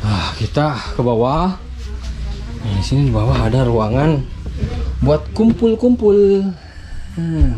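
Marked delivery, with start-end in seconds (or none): none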